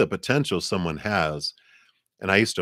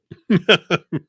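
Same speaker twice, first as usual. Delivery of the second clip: high bright laugh; 12 semitones higher, 8 dB louder than usual. Real, not acted. Laughs at herself.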